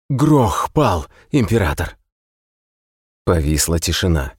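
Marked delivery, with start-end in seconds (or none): 0.1-2.0 gasping, urgent high cry; breathless messenger. Acted. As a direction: blurt it out choking for breath. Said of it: "захлёбываясь дыханием".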